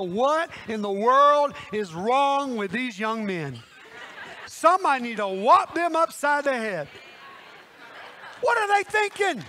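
loud, rising comic exasperation; playful scolding disbelief; exasperated. Acted. Exasperated at the young men.

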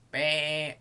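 sheep's bleat. A narrator's reading. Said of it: "said the sheep".

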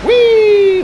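high, loud squeal of delight; gleeful cheer. Real, not acted. Gamer launching the jetpack, gleeful. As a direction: whoop it like riding a rocket.

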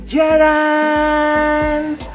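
high, sustained fanfare cry; ta-da reveal. Real, not acted. Vlogger revealing the chef's finished bulalo, triumphant ta-da. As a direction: present it with a long held note.

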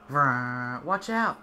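vroom then yell watch out; mock engine and warning. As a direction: make a car noise, then shout a warning. Car noise then warning.

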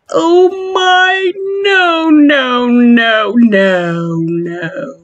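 backing off in real fright, voice trailing down; scared. Real, not acted. Vlogger spooked by a prop in the maze, high frightened no's. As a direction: panicky high "no, no, no" fading away.

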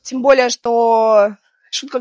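ordinary delivery

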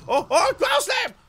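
high, loud excited yell; yelled attack call. Real, not acted. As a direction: shout the move in mid-fight. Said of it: "how many times I've yelled ground slam".

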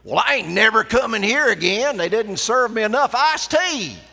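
broad rural North Carolina accent, high and loud, a complaining diner; rural Southern dialect. Shown as a demonstration. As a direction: play a riled-up country customer in broad North Carolina accent. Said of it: "the dialect that really makes all the English teachers cringe".

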